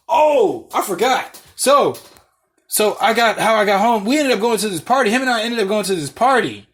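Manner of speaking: high, loud burst of remembering; real sudden excitement. Real, not acted. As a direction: blurt it out, thrilled by the memory. Suddenly remembers the party story.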